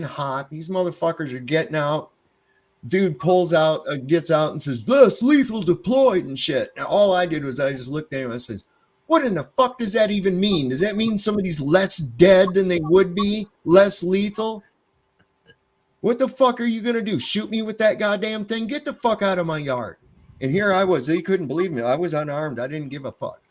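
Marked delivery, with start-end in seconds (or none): 4.9-6.2 higher, louder, slow official callout; cop callout. Retold or imitated. Officer announcing weapon to the scene, loud slow callout. 9.1-20.0 incredulous yelling. Retold or imitated. Homeowner telling off the officers, higher incredulous yelling.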